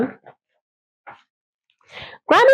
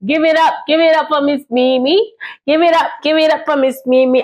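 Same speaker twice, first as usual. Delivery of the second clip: self-cheer; 7 semitones higher, 3 dB louder, faster than usual. Pretend. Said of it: "cheers for herself".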